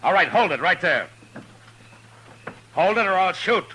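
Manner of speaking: high, loud order to freeze; commanding shout. Acted. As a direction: stop the gunman cold. Marshal confronting unseen gunman.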